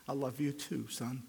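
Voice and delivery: hushed, soft fatherly comfort; tender reassurance. Retold or imitated. God holding and hugging a broken sinner, quietly tender. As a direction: softly reassure a beloved child.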